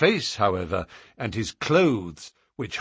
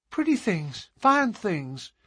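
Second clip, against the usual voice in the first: put-on high, eager little-girl voice; a delighted small girl showing treasures; enthusiastic child; 8 semitones higher than usual. A narrator's reading. Little girl shows the man her mica, high and eager. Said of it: "cried the little girl enthusiastically".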